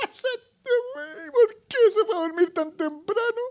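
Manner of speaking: very high, hammed-up wailing; mock wailing mother. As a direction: sob over the child like it's a tragedy. Mocking mother lamenting sleeping child. (madre que llora exageradamente porque el niño se durmió)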